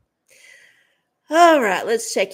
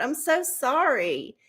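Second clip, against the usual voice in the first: ordinary talk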